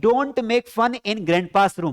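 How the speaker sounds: very slow, word by word, for the class; slow model sentence. Shown as a demonstration. Teacher dictating an English sentence to students, very slowly. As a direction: spell each English word out slowly for learners. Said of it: "तो क्या बोलेंगे".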